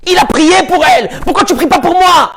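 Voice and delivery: high shouted reproach; reproachful shout. Acted. Rachel confronting her husband Yaakov about prayer: shouted reproach. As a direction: demand it, stung and indignant.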